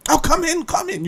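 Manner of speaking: high-pitched imitation of a hostess; mock eager host voice; insistent welcoming host. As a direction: usher them in, high and softening. Acts insistent Italian neighbour wife.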